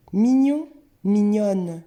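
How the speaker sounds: "Mignon" ends nasal, "mignonne" on a sounded n; teacher contrasting nasal "Mignon" with "mignonne"; changed pronunciation. Shown as a demonstration. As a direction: model "Mignon" rising, then "mignonne" falling with clear n. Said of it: "ça change la prononciation".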